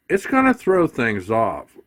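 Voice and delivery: ordinary talk, nothing marked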